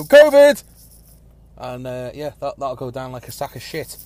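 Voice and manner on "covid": sudden loud, high yell of "covid!"; mock alarmed shout. People outside hearing a cough, mock alarmed shout. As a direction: shout it like a startled passer-by. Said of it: "people will just go".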